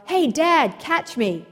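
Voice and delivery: a boy's call from above, retold; retold yell. Son shouting down to his father from the cliffs, mock yell. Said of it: "heard a voice above him yell".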